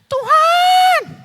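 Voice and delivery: teriakan suara perempuan tinggi melengking; suara wanita. Acted. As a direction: panggil Tuhan dengan suara wanita yang jauh lebih tinggi. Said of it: "Oh, suara wanita, ganti dulu". (wanita pendarahan berseru kepada Tuhan, suara perempuan tinggi)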